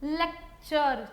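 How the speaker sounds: लेक्चर stretched very slowly, the चर clear; slow demo word. Shown as a demonstration. Teacher to shorthand students, slowly modelling the word. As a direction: draw out लेक्चर so the चर is heard. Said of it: "देखिए लेक्चर".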